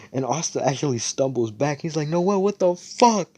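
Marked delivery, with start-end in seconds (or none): 2.1-3.3 high, drawn-out protest; slow, high-pitched outraged cry; acted outrage. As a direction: wail in mock indignation at a slap. Character protests after being slapped.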